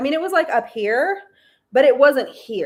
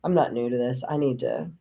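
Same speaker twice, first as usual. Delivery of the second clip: low, humbled reply; resigned acceptance; 8 semitones lower, 3 dB quieter than usual. Retold or imitated. Answering God after finishing the dishes, low resigned acceptance. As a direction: give in, dropping the pitch well down.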